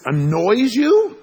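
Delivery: drawn-out, loud, high disbelief; incredulous echo. Retold or imitated. To buddy who used a mild word, incredulous. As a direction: throw the word back, appalled.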